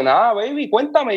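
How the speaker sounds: lightly voiced friend asking for news; imitating Dani. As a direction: toss it off high and easy, like a buddy. Dani's reply retold playfully.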